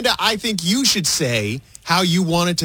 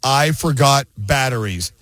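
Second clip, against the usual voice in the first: low, slow, ominous dread on the three words; slow, ominous ad read; 4 semitones lower, slower than usual. Acted. Ad announcer, holiday dread warning: slow ominous read. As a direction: drag it out like a spooky warning. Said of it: "let me hear how I say it".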